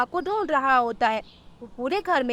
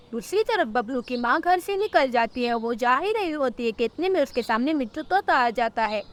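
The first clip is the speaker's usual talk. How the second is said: ordinary delivery, nothing marked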